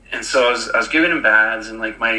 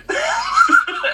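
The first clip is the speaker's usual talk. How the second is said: high burst of laughter; amused laugh. Real, not acted. Dad telling of the little red handprint, amused high laugh. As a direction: break up laughing, high-pitched.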